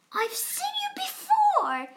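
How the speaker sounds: very high, loud character squeal; high squeaky bird voice; shocked squeal. A narrator's reading. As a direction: shriek it like a startled bird. Maisie recognizes Horton.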